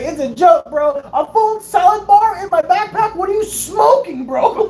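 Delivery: high, loud incredulous rant; hammed-up disbelief, climbing in pitch; exasperated protest. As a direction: plead loudly that it was only a joke. Acts out outraged protest.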